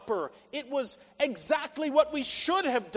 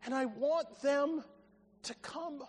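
slow appeal fading to very soft; a father yearning, hushing on the last words; heartfelt longing; 4 dB quieter, slower than usual. Acted. God tenderly longs for lost children.

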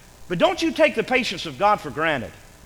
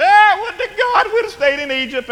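mock wailing; 5 dB louder than usual. Israelites bemoaning leaving Egypt, mock wailing. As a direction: wail it like a hammed-up complainer. Mocking Israelites' old complaint.

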